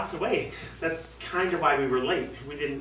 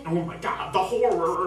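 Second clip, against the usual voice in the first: mock horror; 4 semitones higher, 4 dB louder than usual.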